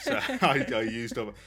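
talking through a loud laugh. Real, not acted. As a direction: laugh mid-sentence, loud. Laughs at having no ideas.

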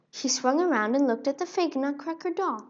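usual speaking voice

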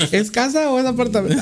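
mocking the triage nurse to his friends, high teasing voice; mock nurse question. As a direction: poke fun at the nurse's useless question. Mimicking nurse's pointless questions.

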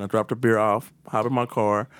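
usual speaking voice

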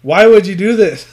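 a yell that dissolves into a laugh; laughing shout. Real, not acted. Trainee yelling at the trainer, cracking up. Laughing through quoted outrage.

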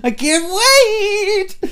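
high, loud, laughing squeal, stretching the last wait; can't hold it in, squeal with glee; giddy squeal drawn out slow; barely contained excitement. Real, not acted. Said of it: "I can barely contain myself".

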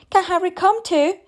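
high mock-child singsong question; imitating the little girl in frustration; exasperated mimicking. A narrator's reading. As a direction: parrot a pestering child, fed up. Mother mimics the child's constant questions.

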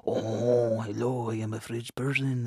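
mock French accent, played up. Shown as a demonstration. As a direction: introduce yourself in a comic French accent. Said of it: "and my French accent".

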